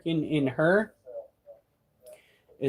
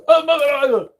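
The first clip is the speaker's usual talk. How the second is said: roach voice; 8 semitones higher, 6 dB louder than usual. Retold or imitated. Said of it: "he goes".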